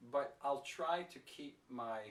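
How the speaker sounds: ordinary delivery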